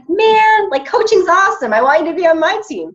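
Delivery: high, loud eager pitch; excited recruiting. Retold or imitated. Coach retells pitching a prospect, loud and eager. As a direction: pour on the enthusiasm. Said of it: "And I'm like".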